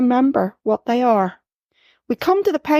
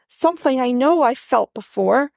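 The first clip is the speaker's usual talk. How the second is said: ordinary delivery, nothing marked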